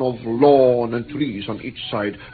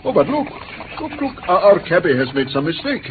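alarmed surprise; 7 semitones higher, faster than usual. Acted. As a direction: cry out as you see the cab going the wrong way. Captive notices the wrong route.

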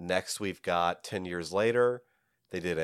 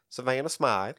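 Southern accent; 5 semitones higher than usual. Shown as a demonstration. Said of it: "so I like to imagine that it's".

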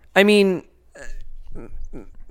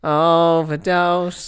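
drawn-out overdose; much slower than usual. Acted. Drawn-out joke word.

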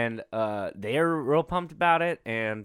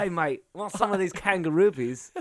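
amateur Aussie, pitched up; attempted Australian accent; 5 semitones higher than usual. Retrying the candy offer in a poor Australian accent. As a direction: put on a not-very-good Australian voice. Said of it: "I can't do it very well".

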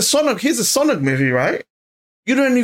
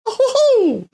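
much higher cheery Mario-style "oh ho ho"; original Mario voice. Retold or imitated. Vlogger mimics the original Mario actor, high cheery chuckle. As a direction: bounce it up high like the classic plumber. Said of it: "the original dude that does it the normal".

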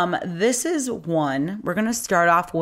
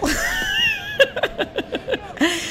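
laughing fit. Real, not acted. Breaks up mid-reading.

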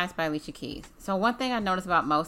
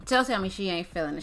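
ordinary delivery

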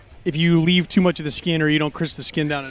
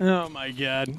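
groaning oh my God; 6 semitones lower, 10 dB quieter, much slower than usual. Real, not acted. Groans at cookie joke.

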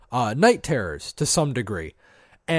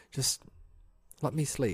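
sleepy mumble; 12 dB quieter than usual. Retold or imitated. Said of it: "I'll be like".

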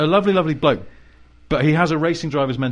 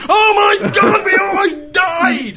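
very high, loud, slow panicked cry; panicked shout; 8 dB louder, much slower than usual. Retold or imitated. Passenger after a crash, loud panicked screaming. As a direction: shout it as if barely surviving. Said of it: "he's not going".

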